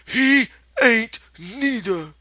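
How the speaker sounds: high, loud, slow defiant retort; belligerent. Acted. Lenny defends George to the rabbit, loud belligerent. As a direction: push back hard. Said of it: "retorted belligerently".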